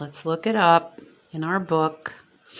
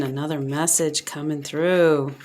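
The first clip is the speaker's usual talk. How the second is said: normal talking voice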